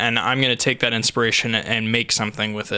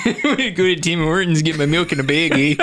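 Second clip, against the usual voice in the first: put-on Canadian accent; 4 semitones higher than usual. Joking Canadian impression after accent remark.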